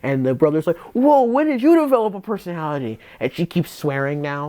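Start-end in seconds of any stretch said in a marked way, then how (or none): 0.9-3.0 high, amazed whoa at his sister's new personality; surprised brother. Retold or imitated. Brother amazed at his sister's new personality, surprised. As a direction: retell the brother's astonished question, pitched up.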